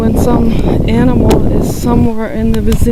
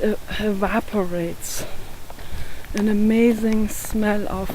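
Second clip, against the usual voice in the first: slow, soft meditation voice; hushed and slow, savour each word; guided meditation; 8 dB quieter than usual. Acted.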